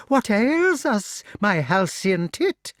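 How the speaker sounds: high, slow, loud witch voice; witch crying out. A narrator's reading. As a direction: cry out with drawn-out theatrical concern. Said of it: "she cried".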